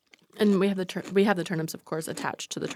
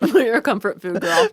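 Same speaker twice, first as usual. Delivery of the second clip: laughing; 5 semitones higher, 7 dB louder, slower than usual. Real, not acted. Laughing teasing friend.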